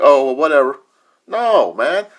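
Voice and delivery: ordinary talk, nothing marked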